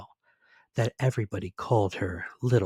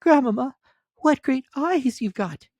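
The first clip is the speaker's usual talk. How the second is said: put-on much higher, louder child voice; little girl voice; 7 dB louder than usual. A narrator's reading. Little girl answering the wolf, high and childlike. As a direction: pipe it up like a small girl.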